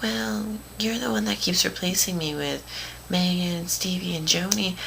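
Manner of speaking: high mocking girl voice; put-on high voice of an aggrieved friend; imitated accusing friend. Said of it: "And she's like".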